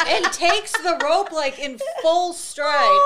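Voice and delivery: words squeezed out through loud laughter, high; can't stop laughing while telling it; laughing hard. Real, not acted. Telling friends about the kid on the rope swing, laughing loudly.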